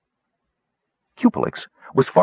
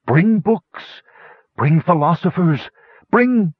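high, halting calls in short bursts; labored plea left hanging; failing dragon; 9 semitones higher, slower than usual. A narrator's reading. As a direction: call last requests, breaking off unfinished. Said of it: "his thought trailed off".

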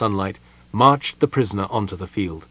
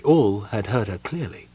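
plain reading voice

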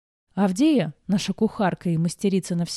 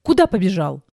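loud sharp growled question; 7 dB louder than usual. A narrator's reading. Lady stops the running boy, growling. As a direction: growl to stop him in his tracks. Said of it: "и тут же рыкнула".

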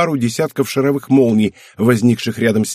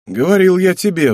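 mild I-told-you-so sigh, pitched up; sighing reproach; 8 semitones higher, 3 dB louder than usual. A narrator's reading. Man to his wife waiting for a taxi, sighing reproach. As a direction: start high and let it fall in weary reproach. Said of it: "вздохнул мужчина".

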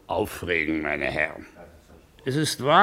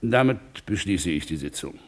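ordinary talk

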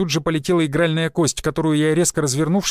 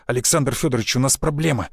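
ordinary delivery, nothing marked

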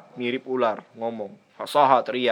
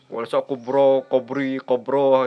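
slurring and mixing up the words; garbled reading; slower than usual. Shown as a demonstration. Teacher showing students a botched reading without harakat. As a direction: mangle it as if reading without vowel marks. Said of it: "ana yakin nanti belepotan ngomongnya".

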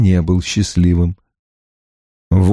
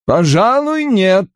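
ordinary delivery, nothing marked

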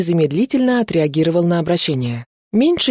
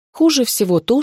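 usual speaking voice

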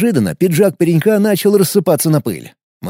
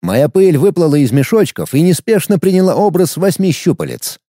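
ordinary delivery, nothing marked

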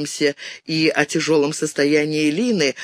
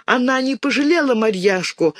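higher, angry shout with a hint of tears; girl accusing the icon, tearful and higher; tearful angry cry; 7 semitones higher than usual. A narrator's reading. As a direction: blame the icon through tears. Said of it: "со слезами и гневом кричала мышка".

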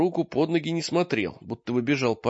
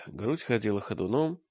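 ordinary delivery, nothing marked

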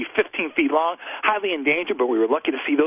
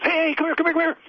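guide calling out; 13 semitones higher than usual. Retold or imitated. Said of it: "the guy goes".

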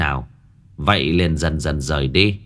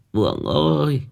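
put-on high, tearful old mother's plea; an old mother calling her lost sons, voice trembling; whimpering old woman; 8 semitones higher than usual. A narrator's reading. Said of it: "Bà cụ mếu máo". (giọng bà cụ mếu máo, cao và nghẹn ngào)

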